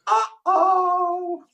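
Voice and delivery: squeaky little kid reacting in the theater. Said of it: "This little kid goes".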